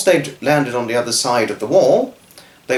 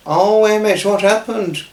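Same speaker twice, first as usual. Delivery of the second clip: worried mother; 8 semitones higher than usual. Acted. Mother finds hurt boy.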